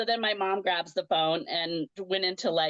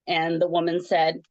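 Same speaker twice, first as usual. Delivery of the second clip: ordinary talk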